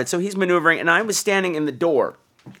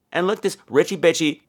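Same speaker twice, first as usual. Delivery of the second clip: ordinary talk, nothing marked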